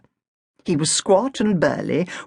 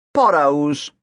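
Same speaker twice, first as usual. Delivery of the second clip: shrugging; 4 dB louder than usual. Acted. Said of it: "he said with a shrug".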